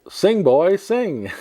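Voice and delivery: mild mock yell, pitched high; lightly acted high call of encouragement; retold motherly yell. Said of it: "heard that and yelled".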